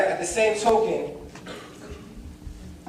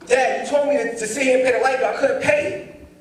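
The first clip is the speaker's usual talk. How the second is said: high-pitched defensive protest; 6 semitones higher than usual. Retold or imitated. Son defending himself over the light bill, protesting. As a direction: plead your case, voice raised in pitch.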